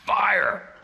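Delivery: a high, yelled burst on the last word; sudden shout. Retold or imitated. Telling viewers how angry he was last night, a high yelled fire. Venting about travel stress.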